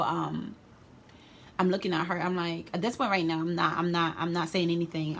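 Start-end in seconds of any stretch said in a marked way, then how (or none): none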